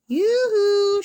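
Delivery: high, loud, drawn-out hail; sing-out "yoo-hoo"; calling shout. A narrator's reading. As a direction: call across a meadow. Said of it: "she shouted".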